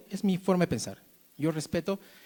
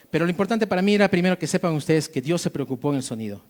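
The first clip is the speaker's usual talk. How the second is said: ordinary talk, nothing marked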